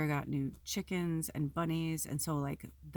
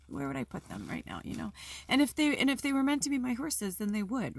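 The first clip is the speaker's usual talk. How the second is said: trying not to cry while talking; real emotion, on the edge of tears; fighting tears; 5 semitones higher than usual. Real, not acted. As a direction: voice pitched up, holding back crying. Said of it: "I'm gonna try not to cry".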